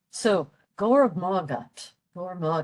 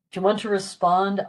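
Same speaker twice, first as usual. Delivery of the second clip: ordinary talk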